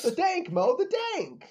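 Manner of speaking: high-pitched mock plea; jokey high voice. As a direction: squeal it playfully, way up high. Jokey mock quote after word correction.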